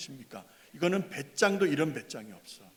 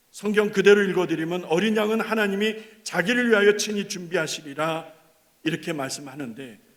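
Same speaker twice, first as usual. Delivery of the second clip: ordinary talk, nothing marked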